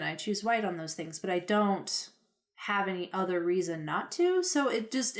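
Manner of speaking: ordinary talk, nothing marked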